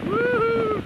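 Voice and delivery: joyful whoop. Real, not acted. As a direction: whoop with pure joy. Cheers out on the ride.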